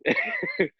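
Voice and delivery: very high burst of a laugh; squealing laugh. Real, not acted. Friend recalling his tearful coming out, high squealing laugh. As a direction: let a squeaky giggle escape.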